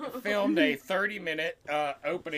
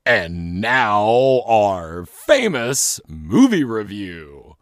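low, loud, slow show announcer; grand mock-showman introduction; announcer intro; 8 semitones lower, 13 dB louder, much slower than usual. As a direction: boom it out, drawing out every word.

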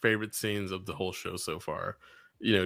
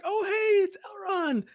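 high-pitched gushing welcome, dropping on the invitation; hospitable wife. Acted. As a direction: play an over-friendly hostess greeting a guest. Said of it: "the most hospitable woman ever".

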